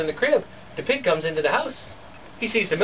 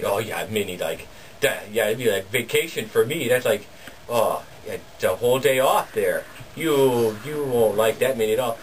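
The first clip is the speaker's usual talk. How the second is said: lower, scoffing farmer husband; gruff husband Jakob; 8 semitones lower than usual. Retold or imitated. Husband scoffing at his wife's plan, low and mocking. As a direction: brush off her silly idea.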